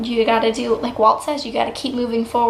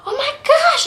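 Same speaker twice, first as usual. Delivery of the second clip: excited squeal. Retold or imitated. Telling mom the news, a high excited squeal. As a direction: shriek it out like you just got the job. Calling mom, screaming with joy.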